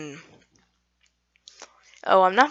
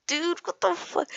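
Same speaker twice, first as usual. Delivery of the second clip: high-pitched retold fright; mock-panicked high voice; scared; 11 semitones higher than usual. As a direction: act out a freaked-out kid, much higher. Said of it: "so I was shitting my pants".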